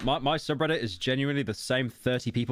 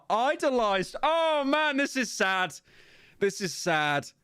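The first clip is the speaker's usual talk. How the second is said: mock sob; 11 semitones higher, slower than usual. Mock sobbing over the post.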